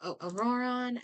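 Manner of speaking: ordinary delivery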